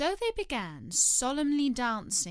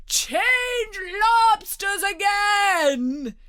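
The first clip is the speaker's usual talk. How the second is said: high, drawn-out shout; top-of-voice yell; 13 semitones higher, 10 dB louder, much slower than usual. A narrator's reading. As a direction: yell it as loud as you can. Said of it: "yelled the Gryphon at the top of its voice".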